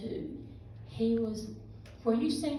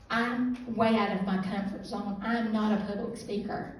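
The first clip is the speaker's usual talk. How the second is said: usual speaking voice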